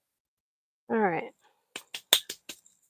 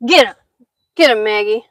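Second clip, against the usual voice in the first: urging the dog; 13 dB louder, faster than usual. Real, not acted.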